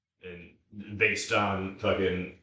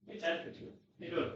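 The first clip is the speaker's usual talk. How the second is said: higher, softer sing-song for the dog; pet voice; 8 semitones higher, 11 dB quieter, faster than usual. Real, not acted. Greeting his dog Ted, higher and softer pet talk. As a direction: coo at your dog.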